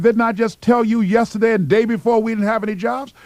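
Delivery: fed-up station boss repeating himself; exasperated boss. Retold or imitated. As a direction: lay into the pest who won't quit. Annoyed boss brushing off applicant.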